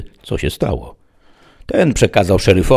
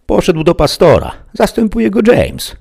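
very high, louder woman's voice; smiling woman; 14 semitones higher, 6 dB louder than usual. A narrator's reading. Woman answers the deputy, smiling high voice. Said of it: "uśmiechnęła się".

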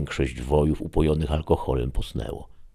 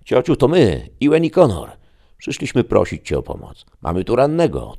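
urgent call; 9 semitones higher, 9 dB louder than usual. Acted. Nephews calling at the window.

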